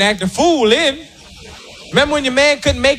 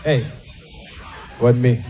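low deadpan denial; 11 semitones lower, 5 dB quieter than usual. Retold or imitated. Man repeats denial.

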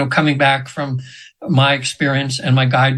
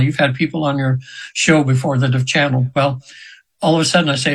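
ordinary talk

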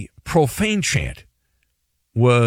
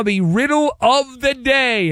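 announcer flourish; 9 semitones higher than usual. Real, not acted. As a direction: herald the riddle like a showman. Announces a named show segment.

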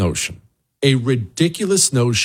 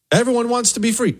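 mock naive claim; 10 semitones higher, faster than usual. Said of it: "voicing the opposing view".

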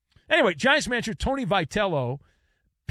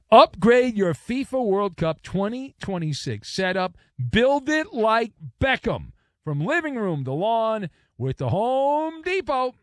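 punchy ad read voice; 5 semitones higher, 4 dB louder than usual. Acted. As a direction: sell it like a radio spot. Live ad read.

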